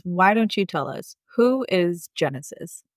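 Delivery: usual speaking voice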